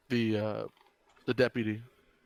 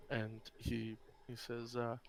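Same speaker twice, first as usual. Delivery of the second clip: normal talking voice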